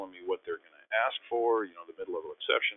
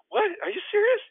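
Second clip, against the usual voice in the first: very high, loud disbelief; incredulous; 5 dB louder than usual. Retold or imitated. Teammate asks who he is once more, incredulous. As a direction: squeak it in mock disbelief.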